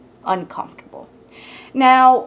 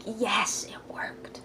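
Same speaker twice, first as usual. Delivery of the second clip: hushed secret "yes"; whispered triumph; 11 dB quieter, much slower than usual. Retold or imitated. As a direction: whisper it privately to yourself, like a plan paying off. Secret triumph voiced as thought.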